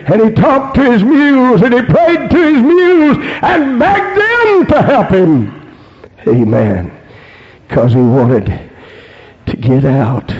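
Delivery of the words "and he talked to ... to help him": high, urgent revival-preacher cry; fervent preaching. Real, not acted. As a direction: drive each phrase higher with preaching fervor. Preaching at full force.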